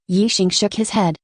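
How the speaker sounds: ordinary delivery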